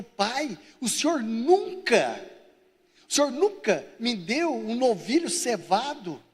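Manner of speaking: resentful son, drawn out and emphatic; indignant complaint. Acted. Older brother to his father about the fattened calf, indignant. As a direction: protest with long, leaning words.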